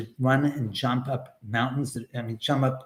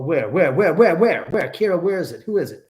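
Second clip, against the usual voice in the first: mock panic; 3 semitones higher, 6 dB louder than usual. Real, not acted. Frantic search for flagged troll.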